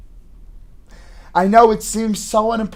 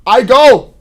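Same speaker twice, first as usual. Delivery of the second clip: high, loud burst; angry shout; 5 semitones higher, 11 dB louder than usual. Acted. Son telling his mother he still goes to work, shouting. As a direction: punch both words out.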